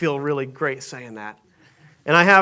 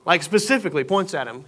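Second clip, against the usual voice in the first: usual speaking voice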